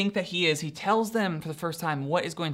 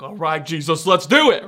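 "let's do it" bursting out much louder; eager agreement; 9 dB louder than usual. Retold or imitated. Peter pumped to follow Jesus, eager shout. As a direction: hype yourself up to follow him.

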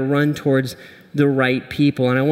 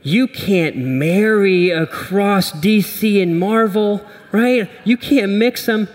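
higher, nerdy protest voice; mock nerd objection; 5 semitones higher, slower than usual. Nerds in the congregation objecting to the pairing, higher mock whine. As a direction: play a fussy comic-book nerd. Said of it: "some of you nerds out there are like".

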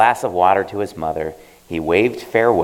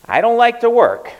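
put-on high, louder whiny boy; boy's voice; 5 dB louder than usual. Bae telling the old man he hates work, high whine. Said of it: "Bae told him".

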